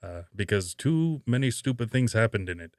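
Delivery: loud Robert Shaw as Quint impression. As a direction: play the crusty shark hunter answering an interview. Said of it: "I'll do it in my Robert Shaw voice".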